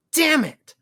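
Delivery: high, loud, exasperated curse; frustrated outburst. Acted. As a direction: blurt it out in frustration. Deadpool disappointed by fake cactus.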